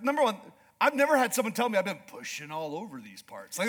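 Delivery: ordinary talk, nothing marked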